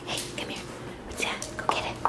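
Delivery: soft whisper to a pet; whispering to a dog. Real, not acted. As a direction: whisper gently to coax the dog. Coaxing the dog quietly.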